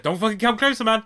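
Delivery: high, loud yell to keep away; imitating a man yelling, pitch pushed up; acted shouted warning. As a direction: shout a warning at someone approaching. Acted warning to approaching clown.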